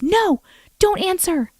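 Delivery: high, slow hushed warning; a child urgently stopping her; young boy warning. Retold or imitated. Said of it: "the young boy's voice from before goes".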